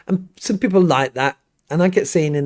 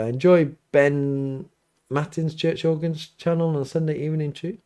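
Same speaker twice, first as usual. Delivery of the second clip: normal talking voice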